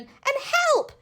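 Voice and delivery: very high scared yell; panicked yell. A narrator's reading. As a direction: yelp for help. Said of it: "yelled the knight".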